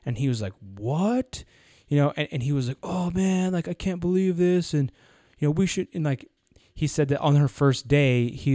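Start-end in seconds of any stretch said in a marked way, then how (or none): 0.6-1.4 drawn-out, sharply rising mock disbelief; incredulous what. Boss reacting to an unexpected new secretary, incredulous. 2.8-5.9 mock dismay. Retelling boss's dismayed reaction.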